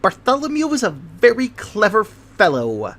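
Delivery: high-pitched chatter. A narrator's reading. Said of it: "in a voice which rose high above the rattles".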